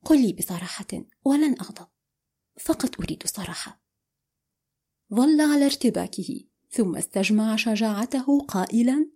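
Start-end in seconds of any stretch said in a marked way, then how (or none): 0.0-3.8 hushed, quick near-whisper; calm and quiet. A narrator's reading. She asks him for honesty, in a soft whisper. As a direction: coax him gently, barely voiced. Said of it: "فقالت بهدوء".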